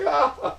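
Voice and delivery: high, loud "ah" of alarm; mock startled cry. Streamer imitates friends dodging thrown keys, mock startled cry.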